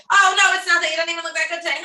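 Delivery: high, loud self-deprecating brush-off; deflecting compliment. Shown as a demonstration. To someone praising your hair, a high mock brush-off. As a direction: wave the praise away, embarrassed. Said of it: "Or do you say".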